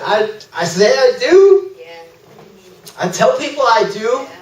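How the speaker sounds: high-pitched, helpless protest; flustered admission. Retold or imitated. Preacher answering the Holy Spirit, flustered. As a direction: pitch climbing in hammed-up cluelessness.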